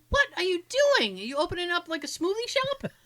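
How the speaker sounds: high-pitched mock disbelief; incredulous teasing. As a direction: ask it high, poking fun at a coworker. Teasing disbelief in a retold chat.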